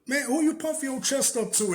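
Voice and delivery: high mock challenge; put-on voice asking who he's puffing up to; imitating himself squaring up to his cousin; confronting him. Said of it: "I'm like".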